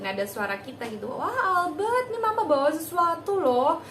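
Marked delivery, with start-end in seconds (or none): 1.1-3.8 put-on high, bright cheerful call to the child; playful, overjoyed mom voice; high excited voice. Shown as a demonstration. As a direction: sound thrilled to win his attention. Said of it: "menarik perhatiannya dia dengan intonasi".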